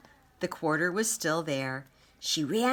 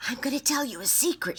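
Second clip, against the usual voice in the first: conspiratorial; 8 semitones higher, faster than usual. Acted. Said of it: "she said".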